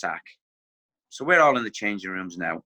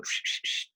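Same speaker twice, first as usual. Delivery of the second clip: whistle blow; 9 dB quieter than usual. Retold or imitated. Manager blowing whistle, imitated.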